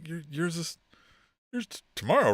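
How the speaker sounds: soft, stammering mock-shy reply; awkward longing. Estranged friend returns a birthday greeting, awkward longing. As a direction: stumble quietly over the words, imitating a flustered friend. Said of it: "that longing bromance sexual tension".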